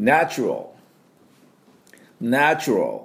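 ナチュラル as "nae-chrol"; English ナチュラル, wide "nae" and reduced ending; repeat-after-me model. Shown as a demonstration. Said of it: "私の後で発音いきましょ".

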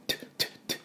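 breathy unvoiced tu tu tu; puffed T bursts; aspirated T drill. Shown as a demonstration. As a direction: spit out the T sound without spitting. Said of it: "Tの音もしっかりね".

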